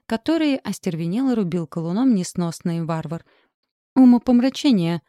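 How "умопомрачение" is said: loud, higher outburst; barked exclamation. A narrator's reading. Tor snaps at his companion while chopping wood, barking. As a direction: bark it out in irritation. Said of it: "рявкнул Тор".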